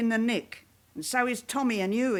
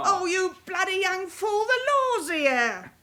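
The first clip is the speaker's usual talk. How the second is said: raised, urgent voice shooing him away; frantic warning; 7 semitones higher than usual. Acted. Mother warns son police are here.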